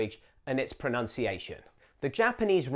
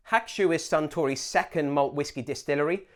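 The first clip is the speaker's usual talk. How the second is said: ordinary talk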